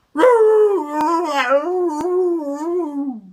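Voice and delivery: high, loud cat wail; cat yowl. Acted. Scarface Claw yowls back at the dogs, high and loud. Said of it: "said Scarface Claw".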